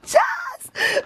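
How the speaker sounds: overwhelmed cry of the name; emotional squeal. Real, not acted. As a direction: voice jumps high, near crying. Reading card sign-off, overwhelmed.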